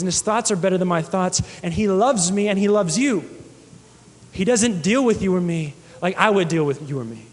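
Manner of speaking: ordinary delivery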